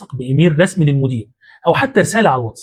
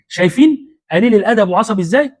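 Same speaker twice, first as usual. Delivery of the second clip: pitched-up fake innocence, slowing on the denial; mock innocent victim; 6 semitones higher than usual. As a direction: play the wronged innocent to the crowd. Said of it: "يقول لهم".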